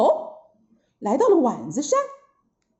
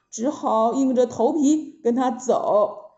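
ordinary delivery

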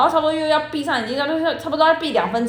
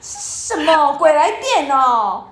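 exasperated disbelief; 3 semitones higher, much slower than usual. Retold or imitated. Exasperated retelling.

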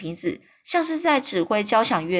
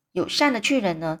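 usual speaking voice

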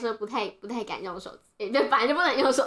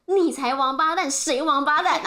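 retold angry comeback; 4 semitones higher, 5 dB louder than usual. Retelling herself pointing at the old man, loud angry comeback. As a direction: throw the insult back, hammed up. Acts out her cursing back.

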